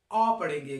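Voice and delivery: ओ said loud and round; teacher answers her own question; rounded o demo. Shown as a demonstration. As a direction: lean on a full, round ओ. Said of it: "को क्या पढ़ेंगे?".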